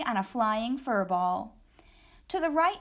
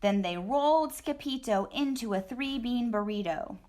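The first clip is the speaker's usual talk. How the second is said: ordinary delivery, nothing marked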